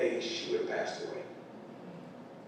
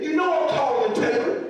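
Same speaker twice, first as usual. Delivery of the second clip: high, loud, whiny protest with a laugh in it; a mock-outraged kid demanding his rights; indignant teen son; 13 semitones higher, 8 dB louder than usual. Son complains to mom about his photo, high whiny mock outrage. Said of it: "He said".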